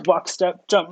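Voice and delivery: ordinary talk, nothing marked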